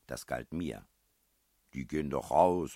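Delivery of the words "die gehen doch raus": loud, mild sulky protest; grumbling. A narrator's reading. Man defending his wine stains to the princess, mildly sulky. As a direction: grumble back at the scolding. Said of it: "maulte ich".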